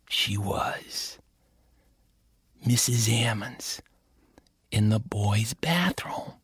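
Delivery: slow dramatic whisper; hushed reveal. A narrator's reading. Narrator revealing the teacher, slow whisper. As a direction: whisper it like the scary part.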